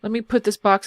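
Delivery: ordinary talk, nothing marked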